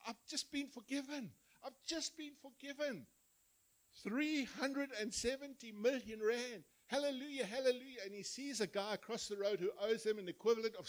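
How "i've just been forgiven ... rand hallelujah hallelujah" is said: high-pitched, overjoyed mock celebration; celebrating. Forgiven servant rejoicing over a cancelled debt, acted-out celebration. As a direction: act out giddy rejoicing, pitched well up. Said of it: "he's celebrating".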